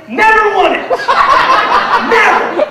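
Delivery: loud, high mock-triumphant jab; gloating. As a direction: crow it over a beaten rival. Gloating over his dad.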